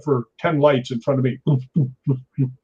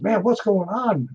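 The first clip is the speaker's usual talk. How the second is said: higher, slow, worried friend; alarmed friend; 6 semitones higher, slower than usual. Retold or imitated. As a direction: ask it like a startled buddy.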